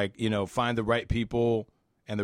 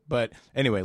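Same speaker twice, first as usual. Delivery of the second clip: ordinary talk, nothing marked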